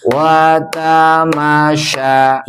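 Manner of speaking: Watamasya read slowly, evenly; slow recitation. Shown as a demonstration. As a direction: say Watamasya slowly with no long vowel. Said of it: "harus jalan terus". (membaca Watamasya datar dan pelan)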